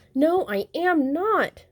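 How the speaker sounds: high, slow protesting cry; indignant shout. A narrator's reading. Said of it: "shouted Little Owl".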